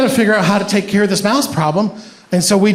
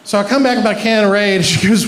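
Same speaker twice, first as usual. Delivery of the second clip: ordinary delivery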